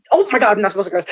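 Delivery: embarrassed laughing yelp; real laughing outburst; laughing shout. Real, not acted. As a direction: burst out laughing at yourself. Catches herself cursing on live.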